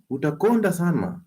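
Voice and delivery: high, squeaky mocking voice with laughs; mock whiny excuse. Doctor mocking listeners who fear fasting, high whiny voice. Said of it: "And you're like".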